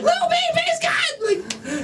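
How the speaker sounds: very high, stretched mock shriek; screaming fan. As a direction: shriek like a fan losing her mind, without full volume. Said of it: "she just starts screaming".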